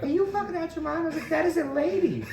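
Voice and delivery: high, fast outraged protest; incredulous yell. Retold or imitated. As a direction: shout your disbelief at them. Retold outrage at officers.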